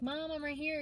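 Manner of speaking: imagined turtle calling. Pretend. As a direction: call out to mom as a pretend turtle. Voicing the turtle calling to her.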